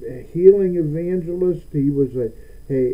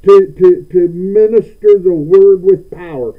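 mock Satan taunt; 4 semitones higher, 9 dB louder than usual. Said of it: "you know".

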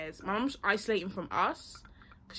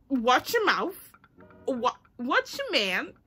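raised, loud retold warning; angry warning; 3 semitones higher, 7 dB louder, slower than usual. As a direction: imitate telling off a cheeky brother. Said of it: "I got angry".

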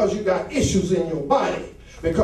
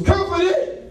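rising preacher's cadence, calling out; full-force preaching; 11 semitones higher than usual. Acted. Preacher calling out to the congregation, fervent.

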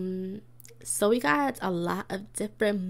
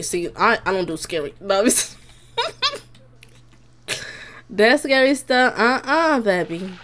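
ordinary talk

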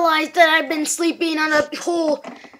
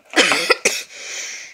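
raspy cough; 10 semitones lower than usual. Acted. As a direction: hack out a dry cough. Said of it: "my throat hurts".

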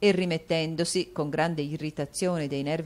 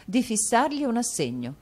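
higher, louder wife's resolve; Madame Mantalini, firm; 7 semitones higher, 3 dB louder than usual. A narrator's reading. Wife announcing her decision, firm. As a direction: lay down the law to her husband.

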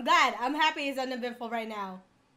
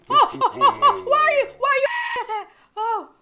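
very high, loud repeated shrieks; panicked screaming; 13 semitones higher, 15 dB louder than usual. Real, not acted. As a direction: shriek and stammer at the attacker. Jump scare in the game.

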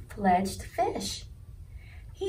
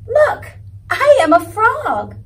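much higher, louder proud cry; triumphant; 11 semitones higher, 11 dB louder than usual. A narrator's reading. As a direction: crow it like a proud little frog. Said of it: "he said triumphantly".

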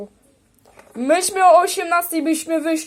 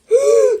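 shocked gasp; 12 semitones higher, 7 dB louder than usual. Pretend. Mourning pretend deaths.

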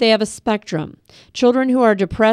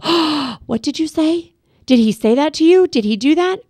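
alarmed overreaction; 6 semitones higher than usual. Retold or imitated. Parent overhearing the child's phone call, higher and alarmed. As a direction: jump in alarmed, the wrong way. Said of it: "don't put down the book and go".

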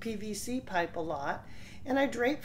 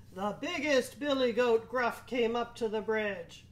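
ordinary delivery

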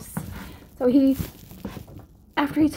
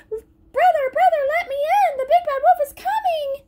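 high, louder frightened pleading; panicked pig; 4 dB louder than usual. Acted. Little pig begging his brother, frightened. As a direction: beg to be let in.

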